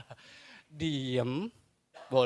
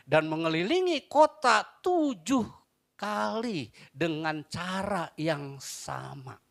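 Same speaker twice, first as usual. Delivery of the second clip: scripture reading; 8 semitones higher, 5 dB louder, slower than usual. Acted.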